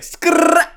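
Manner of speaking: loud high skid sound effect; tire screech noise. Retold or imitated. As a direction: screech it like tires skidding.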